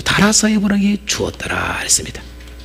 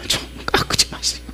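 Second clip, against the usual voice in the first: soft unvoiced plea; whisper; 9 dB quieter than usual. Real, not acted. Preacher whispering advice to the women, hushed. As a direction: lean in and whisper the request.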